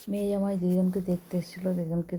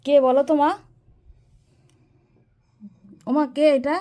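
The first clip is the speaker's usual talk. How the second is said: loud and clear, calling out; to someone hard of hearing; 5 semitones higher, 7 dB louder than usual. Real, not acted. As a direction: raise the voice so grandma hears. Calling to elderly mother, loud.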